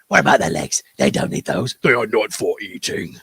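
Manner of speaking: low, grim Lord of the Rings goblin; serious goblin voice. Retold or imitated. Imitating the Lord of the Rings goblins, deep and deadly serious. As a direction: growl it out like a deadly earnest goblin. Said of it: "that serious kind of goblin voice".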